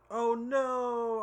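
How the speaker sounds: loud, high drawn-out "oh no"; mock dismay. Real, not acted. Reading that the cash stash competition has closed, loud dismay. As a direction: cry out in dismay.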